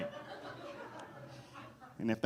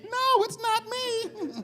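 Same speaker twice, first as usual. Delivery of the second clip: high, slow, spooky denial; mock spirit voice; much slower than usual. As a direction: play the guilty friend as a ghostly whisper-high voice. [name] answers in mock spirit voice.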